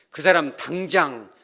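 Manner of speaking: loud, slow, furious boss; angry firing. Retold or imitated. Boss firing the careless mechanic, loud and slow. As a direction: bellow the dismissal like an enraged boss.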